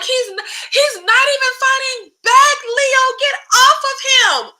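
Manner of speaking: high-pitched imitation of screaming; mock scream. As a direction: mimic a frantic yell without full volume. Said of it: "she's screaming".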